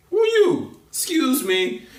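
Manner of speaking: high sassy rebuff; mock girl's voice. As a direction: put him down in a high dismissive girl voice. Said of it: "And the girl's like".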